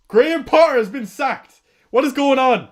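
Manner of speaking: loud, high burst of disbelief; shocked outburst. Real, not acted. Streamer to chat, shouting the shock news in disbelief. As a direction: blurt out the news in real shock.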